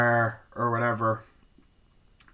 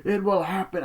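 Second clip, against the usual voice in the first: mock proclamation; 8 semitones higher, 3 dB louder than usual. Man in the film making a pronouncement, mock proclamation.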